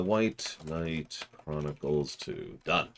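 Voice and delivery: usual speaking voice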